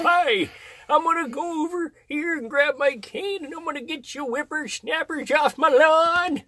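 high, put-on old grandpa. Shown as a demonstration. Showing how to do a grandpa voice, high old-timer. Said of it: "how you do a grandpa voice".

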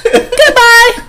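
loud falsetto send-off; high, loud joking goodbye; mock dismissal. Man jokingly dismisses the ignored woman, mock farewell.

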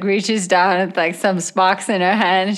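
ordinary delivery

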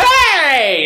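drawn-out high, loud yell; screaming little kid. Retold or imitated. Upset little brother screaming at his sibling over the toppled blocks, screaming. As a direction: holler it out like a wronged kid. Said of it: "like, screaming, like".

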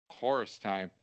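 ordinary delivery

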